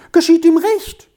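very high, loud mimicked child; quoted child. A narrator's reading. Teacher quoting the child to the father, high mimicked voice. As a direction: mimic a child's pert retort, pitched right up.

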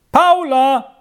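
loud, high call up the stairs. Acted. Boy calls his sister from downstairs, loud and high. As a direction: shout her name from below. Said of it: "rief er schon von unten".

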